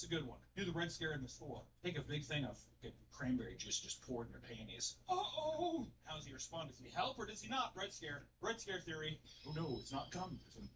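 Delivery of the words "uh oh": mock uh-oh. Reacting to imagined prank.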